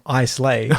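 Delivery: "Islay" read as spelled, with S and AY; tourist's EYE-slay for "Islay"; mock mispronunciation. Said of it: "I didn't know that at first".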